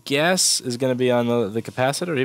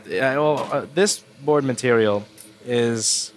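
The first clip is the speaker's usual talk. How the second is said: normal talking voice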